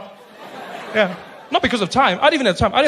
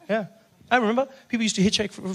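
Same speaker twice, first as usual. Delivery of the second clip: ordinary talk, nothing marked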